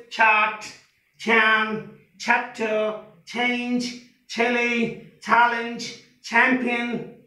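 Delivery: level list, "chart" with a light छ; correct ch drill. Shown as a demonstration. Teacher models the words for students, careful pronunciation. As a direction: say "champion" and each word with breathed ch. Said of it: "like this".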